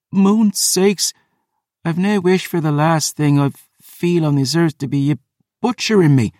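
high-pitched Scottish protest; pained Scottish woman. Acted. Phoebe protests being butchered, high and pained. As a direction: object through the agony.

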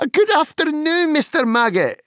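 very high, loud hobbit voice; young hobbit's greeting. Acted. Pippin greeting the gruff farmer, high hobbit voice. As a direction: pipe up loudly in a high voice.

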